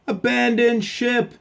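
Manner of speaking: high, loud, stretched-out order; panicked captain's shout. Acted. Captain fleeing the sea serpent, high alarmed shout. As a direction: yell it to the whole crew.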